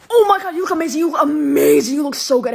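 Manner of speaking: loud, high, rapid-fire compliments; hammed-up excited squeal of praise; gushing girl. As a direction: gush all over her at full volume. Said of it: "this girl was like".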